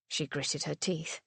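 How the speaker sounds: ordinary delivery, nothing marked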